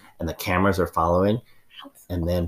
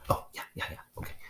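whispering; 13 dB quieter, faster than usual. Acted. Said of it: "lower your voice".

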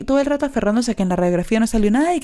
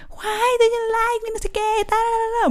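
imitating Pearl's scream. Retelling to listeners how her scream sounded like Pearl's, high mock wail. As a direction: ham up the movie villain's shriek in a thin high voice. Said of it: "Cuando esta mujer dice".

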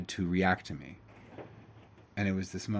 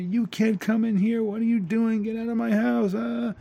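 high, loud mock screaming at an intruder; retold angry yell; 12 semitones higher, 4 dB louder than usual. Man yelling at the electrician at his door, loud and high. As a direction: bark him out of the house, pitched way up. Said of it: "starts screaming at me and yelling at me".

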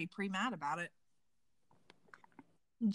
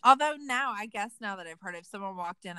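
normal talking voice

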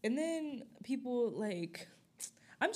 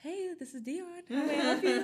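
high, sweet customer-service put-on; mom's phone voice; 8 semitones higher than usual. Daughter imitating mom answering a work call, high polite phone voice. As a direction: switch into polite office phone voice. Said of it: "Why is she talking like that on the phone".

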